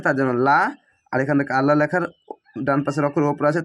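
usual speaking voice

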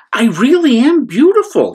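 high, loud proud voice; proud fish. Acted. Rainbow Fish boasting to the starfish, high and proud. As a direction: insist on your beauty. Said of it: "he said".

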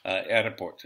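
"airport" said AIR-uh-port, Russian style with an extra vowel; Russian "airport" demo. Shown as a demonstration. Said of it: "that's the way you're supposed to say it".

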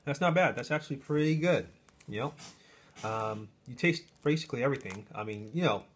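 chewing while talking; speaking between bites; mouth full. Real, not acted. Seen 0: Holds bitten sandwich; lips pressed, chewing motions between words after bite.

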